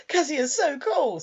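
high, slow, dreamy mock swoon; swooning pervert. Evil pervert upstairs, flushed and happy, imitated swooning. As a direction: gush like a smitten villain. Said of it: "looking all flushed and happy".

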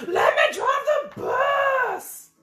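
very high, slow furious shout; tantrum scream. Acted. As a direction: scream it in a meltdown. Pigeon's tantrum.

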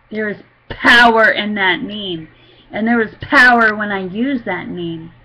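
loud, with POWER punched; fervent testifying. Real, not acted. Testifier proclaiming the power in that name, fervent.

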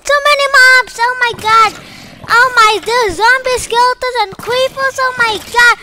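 loud, high frantic panic; panicked yelling. Real, not acted. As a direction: yelp in real panic at the mobs. Panicking at a mob spawner.

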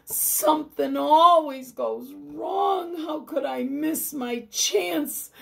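sick man who missed his chance at healing; anguished lament. Acted. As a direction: berate yourself in grief. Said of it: "Hiram cried to himself".

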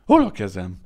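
loud, bewildered question; a shocked loud ask; dazed cry. A narrator's reading. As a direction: call out lost and stunned. Maimed man asks for hands.

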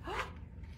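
sharp excited gasp; a quick surprised in-breath; big storytime gasp. Acted. Story reveal, gasp before find.

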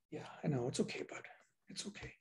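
soft hushed reassurance to a pet; calm the little buddy down gently; soothing. Real, not acted. Preacher hushing his interrupting pet, soft and tender.

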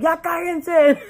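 imitating dad's high, very loud yell; retold yell. Retelling dad yelling at the party, high mock shout. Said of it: "He went out and yelled at him".

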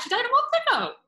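high, loud, exasperated mock complaint; mock indignant protest. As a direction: protest loudly at a feeble excuse. Acts out mock protest at excuse.

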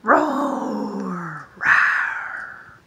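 low breathy mock roar for the kids; hammed-up lion roar. Shown as a demonstration. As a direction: growl it like a playful lion. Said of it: "growl like a lion and tiger?".

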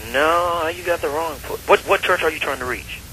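normal talking voice

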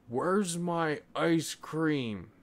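put-on high, slow, drawn-out childish call; a stubborn boy hunting for his treat; high slow little boy's plea. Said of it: "called Isaac".

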